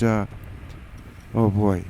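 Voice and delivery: normal talking voice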